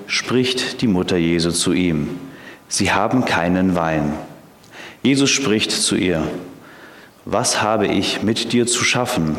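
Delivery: ordinary talk, nothing marked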